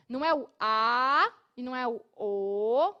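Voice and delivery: ordinary talk, nothing marked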